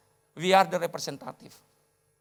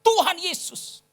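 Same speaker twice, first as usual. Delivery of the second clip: retold scream; 11 semitones higher, slower than usual. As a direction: imitate a frightened attendant crying out. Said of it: "lalu pramugarinya teriak". (teriakan tiruan bernada tinggi)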